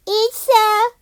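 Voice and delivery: high, loud cry of alarm; startled scream of bear; alarmed shout. A narrator's reading. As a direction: yell it as the bear appears. Discovering the bear.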